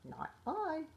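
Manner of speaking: high, quacky duck voice; quacking duck voice. A narrator's reading. Duck turns down the hen, with high quacky refusal. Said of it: "duck quacked".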